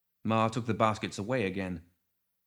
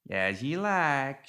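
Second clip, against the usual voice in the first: higher, very drawn-out gremlin drawl; Ma Tuckett; 5 semitones higher, much slower than usual. A narrator's reading. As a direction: stretch it out like a backwoods matriarch. Ma Tuckett's voice, goading.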